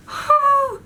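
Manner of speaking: high, loud voiced sigh; exasperated exhale with high voice. Real, not acted. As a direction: let out a big exasperated sigh. Exasperated pause in story.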